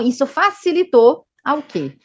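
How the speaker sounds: usual speaking voice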